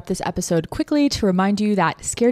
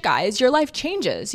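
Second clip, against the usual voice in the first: normal talking voice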